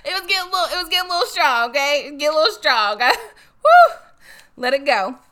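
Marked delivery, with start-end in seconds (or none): 0.0-3.6 loud, high voice through laughter; laughing. Real, not acted. Laughing at her own remark. 3.6-4.0 very loud, high "woo" after laughing; laughing whoop. Real, not acted.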